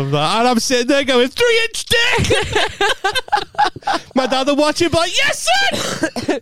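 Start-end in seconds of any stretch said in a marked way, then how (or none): 1.3-2.4 very high-pitched squealed shout; mock shout. Mimics self yelling it. 5.1-6.4 high-pitched mock cheer; cheering dad. Acts dad cheering at screen.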